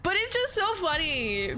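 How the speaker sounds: ordinary delivery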